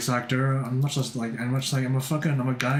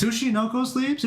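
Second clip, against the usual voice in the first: high, questioning echo; puzzled re-reading; 10 semitones higher than usual. Real, not acted. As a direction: read it back in surprise.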